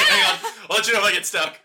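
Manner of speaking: high, laughing fake confidence; mock bluff. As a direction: pretend you have it all solved. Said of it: "Just be like".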